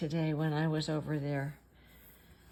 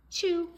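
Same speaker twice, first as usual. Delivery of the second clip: sneeze; 9 semitones higher than usual. Acted. Final sneeze.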